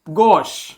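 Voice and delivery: गॉश said as "gosh", low, with a clear open o; pronunciation model. Shown as a demonstration. Teacher models गॉश for students to repeat. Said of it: "इसका pronunciation हो जाता है".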